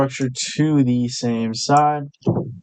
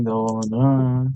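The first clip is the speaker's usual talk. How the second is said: ordinary talk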